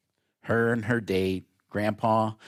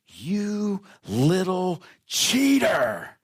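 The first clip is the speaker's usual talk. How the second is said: slow, high, loud furious roar at the cheater; furious grandpa; 9 semitones higher, 4 dB louder, much slower than usual. Retold or imitated. Grandpa catching the boy cheating, furious slow roar. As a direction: bellow it like an outraged grandfather.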